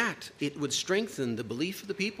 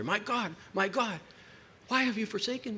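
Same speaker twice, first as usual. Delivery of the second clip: a pleading cry to God, pitched higher; higher, pleading voice of forsaken Jesus; anguished cry; 7 semitones higher than usual. Acted. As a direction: cry out to a God who has left you. Quoting Jesus's cry on cross.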